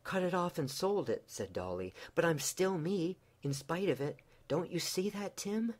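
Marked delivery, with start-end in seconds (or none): 0.0-1.2 high, urgent pleading; high pleading wife. Acted. Wife admitting to husband she sold her hair, pleading. Dolly answers Tim tearfully. 2.2-5.8 high, urgent pleading; high pleading wife. Acted. Wife admitting to husband she sold her hair, pleading. Dolly begs Tim.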